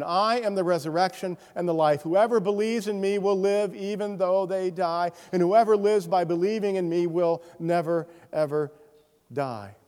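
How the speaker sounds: lifted, ringing scripture quote; preaching scripture. Acted. Pastor proclaiming Jesus' words to the congregation, ringing preaching. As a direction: proclaim it like a promise.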